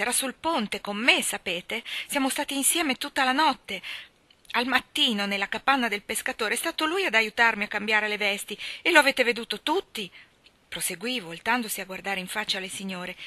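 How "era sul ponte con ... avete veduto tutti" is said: loud, raised, insistent voice; forceful outburst. A narrator's reading. Mally insisting to the ladies he was with her, forcefully. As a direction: argue it forcefully, point by point. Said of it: "sbottò a dire con forza".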